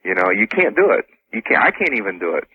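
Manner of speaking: ordinary talk, nothing marked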